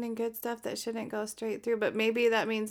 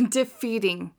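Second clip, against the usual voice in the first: ordinary talk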